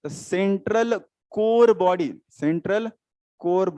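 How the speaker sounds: each word drawn out slowly for notes; slow dictation. Shown as a demonstration. Teacher to class, dictating the term slowly. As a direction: dictate the term slowly so students can write.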